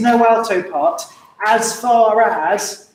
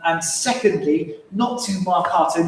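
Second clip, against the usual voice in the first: usual speaking voice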